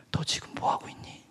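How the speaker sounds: whispered, as God's voice; a soft divine whisper; God's quiet question. Acted. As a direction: whisper the question gently from above. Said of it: "주님이 한 말씀 하셨어요".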